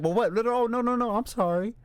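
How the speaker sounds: high, flustered mock panic; flustered backpedal. Nikki snapping back to her boyfriend, high flustered apology. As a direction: suddenly caught out, scrambling to apologise.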